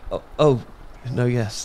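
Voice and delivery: usual speaking voice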